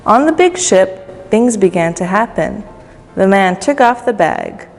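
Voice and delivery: plain reading voice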